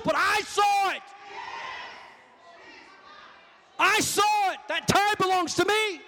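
high, ringing accusation as God; outraged preacher voicing God's claim; indignant shout. Voicing God's angry accusation of Achan.

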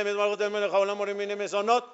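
imitating careless people who skip the pause after "atah"; rushed blessing. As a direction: rattle off "Baruch atah Melech haolam" without stopping. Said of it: "Hay mucha gente dice".

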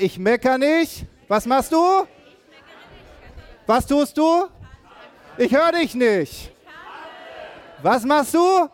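high, loud shouted call to the crowd; rallying shout. Real, not acted. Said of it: "das darfst du jetzt ganz laut aussprechen".

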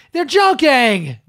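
drawn-out exasperated "They're joking", dragged out slowly. Real, not acted. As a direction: spell it out for them, slowly and annoyed.

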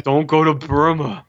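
lightly voiced action hero warning; a hint of mock hero doom; Rambo impression. Rambo repeating his warning to missionaries, mock stern hero. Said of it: "it's John Rambo".

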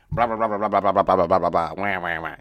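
loud dismissive babble, brushing it all off; mocking blah blah. Podcaster dismissing his old complaints, mocking babble. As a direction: wave away the whining with noise.

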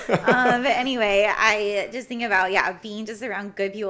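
choked-up laugh while pushing on; emotional laughter. Real, not acted. Telling her host about her dream future, emotional laughing. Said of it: "I don't know why I'm getting emotional right now".

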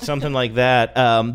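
ordinary talk, nothing marked

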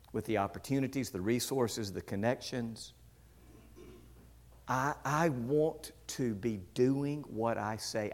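soft, low prayer with long pauses; earnest prayer. Acted. Preacher to the Lord about living what he says, earnest prayer. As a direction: pray softly and earnestly. Prays aloud mid-sermon.

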